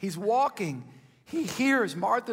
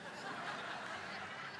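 soft laugh; 16 dB quieter than usual. Real, not acted. Preacher laughs at own joke.

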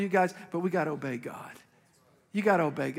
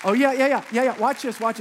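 excited; 5 semitones higher, 7 dB louder than usual. Real, not acted. Pastor excited outburst.

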